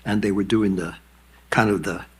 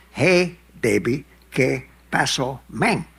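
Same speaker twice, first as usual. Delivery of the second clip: put-on "Hey, baby, ¿qué pasó, men?" stretched slow, higher; 4 semitones higher, 4 dB louder, slower than usual. Shown as a demonstration. Showing what the Cuban greeting sounds like said slowly. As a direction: drag out each word of "¿qué pasó". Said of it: "at that speed".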